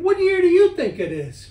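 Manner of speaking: high, loud demanding question; loud challenge. Retold or imitated. Man questioning a ghost about the year, loud and demanding. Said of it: "And then I said".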